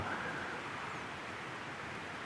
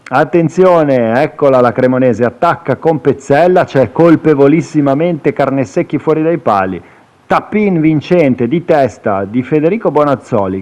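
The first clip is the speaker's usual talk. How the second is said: breathless italian goal commentary; 4 semitones higher, 3 dB louder than usual. Acted. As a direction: draw out Attenzione, then call the goal.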